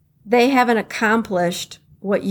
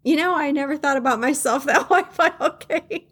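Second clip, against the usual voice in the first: laughing reply; 5 semitones higher than usual. Retold or imitated. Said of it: "And I'm like".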